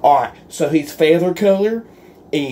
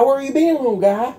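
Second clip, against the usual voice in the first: high, cooing pet voice; talking to a pet; 9 semitones higher, faster than usual. Acted. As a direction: coo at the little rooster like a baby. Talking to the rooster.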